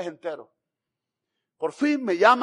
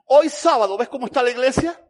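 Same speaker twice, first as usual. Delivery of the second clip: boastful pastor; 4 semitones higher, 7 dB louder than usual. Retold or imitated. Pastor showing off his church.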